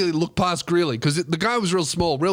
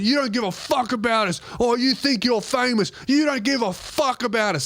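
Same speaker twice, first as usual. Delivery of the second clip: a high, lightly voiced angry drunk; mock drunk anger; 6 semitones higher than usual. Drunk fan sneers at the comedian, mock angry. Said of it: "he starts following".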